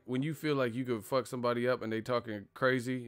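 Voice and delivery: usual speaking voice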